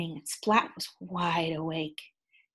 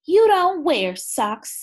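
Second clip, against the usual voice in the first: 1 mom voice; 7 semitones higher, 7 dB louder, slower than usual. Acted. Mom's reply read aloud.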